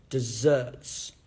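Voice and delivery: "Desserts" with the weight on "-serts"; second-syllable stress. Shown as a demonstration. Teacher repeats the word for kids, "Desserts" stressed on "-serts". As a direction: say "Desserts" alone, punching the last syllable. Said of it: "why word stress is important".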